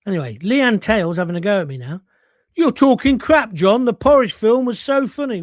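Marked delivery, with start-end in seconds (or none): 2.5-5.4 raised, punchy mock outrage; indignant listener. Host voicing Leanne's complaint about the film, loudly indignant. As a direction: read the complaint loud and high. Acts out listener's angry message.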